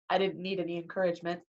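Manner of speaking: plain reading voice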